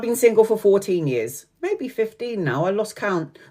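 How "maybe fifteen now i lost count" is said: softer, slower admission; quiet aside. Real, not acted. Correcting her fourteen years single, quiet aside. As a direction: drop it in quietly, almost to yourself.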